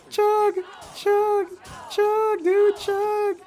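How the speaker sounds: high, loud chanting; cheering shout. Real, not acted. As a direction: cheer them on. Cheering a drinking contest.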